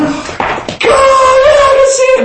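very high, drawn-out acted cry; overjoyed amazement. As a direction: burst out as if seeing for the first time. Acting healed man seeing for first time.

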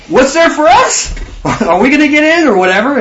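high, whiny Peter asking what he gets; mock Peter. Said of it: "What does he say here?".

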